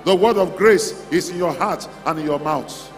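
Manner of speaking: ordinary talk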